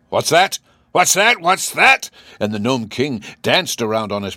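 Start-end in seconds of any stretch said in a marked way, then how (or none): 0.1-2.1 high, loud, rising outraged questions; enraged. A narrator's reading. Enraged king challenges his insolent general, high and loud. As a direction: fire it off higher and louder, incredulous. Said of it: "He was so enraged".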